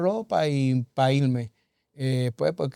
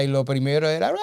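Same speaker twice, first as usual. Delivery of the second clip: mock nagging yell; faster than usual. Tired husband imitating the yelling that greets him, mock nagging yell. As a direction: imitate endless yelling with a rolled wail.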